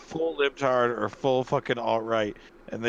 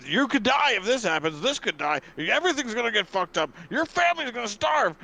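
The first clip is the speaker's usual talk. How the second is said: higher, louder, mock-alarmist newscaster; mock news hype; 10 semitones higher, 3 dB louder than usual. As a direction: ham up the doom like scaremongering TV news. Mocking fearmongering news hype.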